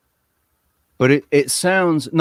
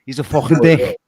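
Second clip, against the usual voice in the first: put-on Liverpool accent. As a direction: do a quick, hammed-up Liverpool accent. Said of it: "he talks like".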